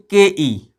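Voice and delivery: usual speaking voice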